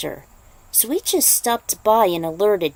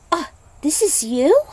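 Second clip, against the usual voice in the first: surprised; 8 semitones higher, 4 dB louder than usual. Acted. Girl surprised at photo.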